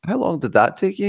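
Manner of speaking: high, hammed-up incredulous gasp; mock surprise. Retelling a chat with a friend who rushed the game, mock surprise.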